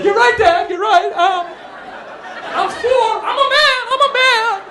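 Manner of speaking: high, loud mock-crying child; tearful little boy. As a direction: wail like a small kid trying to be brave. Crying four-year-old answers his father.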